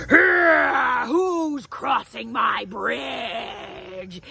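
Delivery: a very slow, shouted troll roar; grumpy troll. Acted. Troll calls up from under the bridge, slow shouted roar. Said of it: "It was the troll".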